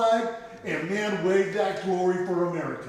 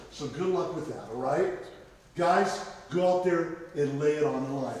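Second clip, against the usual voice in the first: ordinary delivery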